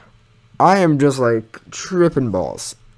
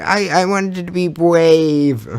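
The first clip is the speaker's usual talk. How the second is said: small, slow sheepish voice; sheepish kid; 5 semitones higher, slower than usual. Retold or imitated. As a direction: mumble it like a guilty little kid. Sheepish excuse to mom.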